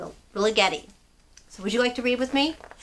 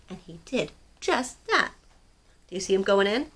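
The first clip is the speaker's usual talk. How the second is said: ordinary delivery, nothing marked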